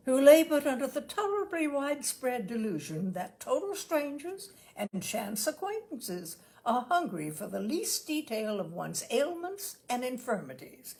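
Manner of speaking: ordinary delivery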